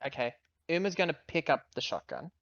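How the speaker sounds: normal talking voice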